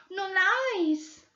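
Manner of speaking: put-on high old woman with a Portuguese accent; Portuguese-accented old woman refusing flatly; Brazilian housekeeper. Old woman stonewalling the detectives in a Portuguese accent.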